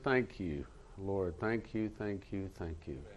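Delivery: low, soft, repeated thanks; murmured thanks. Retold or imitated. Man walking around thanking the Lord, soft low murmur.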